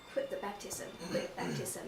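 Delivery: whispered, insistent inner voice; inner voice whispering. Retold or imitated. Tempting voice urging her to quit, whispered. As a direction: breathe it like a doubting voice in the head. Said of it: "I have this kind of voice".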